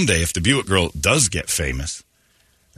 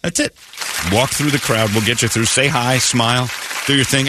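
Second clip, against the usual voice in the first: ordinary talk, nothing marked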